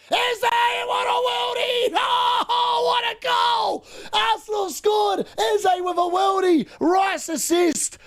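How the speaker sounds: ecstatic high shouted goal call; long goal yell. Real, not acted. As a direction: scream the goal, stretching every word. Goal celebration during live match.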